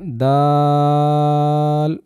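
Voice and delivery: দাল held very long; drawn-out letter. Shown as a demonstration. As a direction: stretch দাল slowly. Teacher models letter. (দাল অনেক টেনে)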